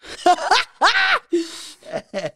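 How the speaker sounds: a long high laugh. Real, not acted. Woman laughing at her own retort to her ex, high laugh. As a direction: burst into a real laugh.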